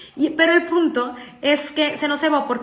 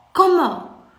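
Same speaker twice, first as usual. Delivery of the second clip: high, loud, imitated outraged disbelief; mock-appalled outburst; shocked; 6 semitones higher, 6 dB louder than usual. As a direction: blurt it like a child denied a promised treat. Daughter learns there is no donut.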